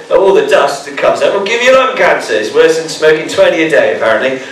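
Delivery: ordinary talk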